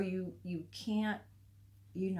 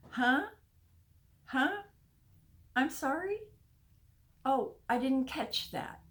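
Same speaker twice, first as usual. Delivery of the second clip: raised, louder puzzled questions; asking for repeats; 9 semitones higher, 7 dB louder than usual. Acted. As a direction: act out not catching what was said, high and loud. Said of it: "and that's saying".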